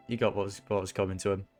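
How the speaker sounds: ordinary talk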